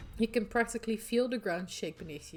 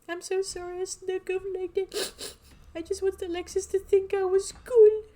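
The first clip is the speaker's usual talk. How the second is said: put-on tearful teen girl; 11 semitones higher, 9 dB louder than usual. Rosalina apologising to the group, tearful and high. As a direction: plead through tears.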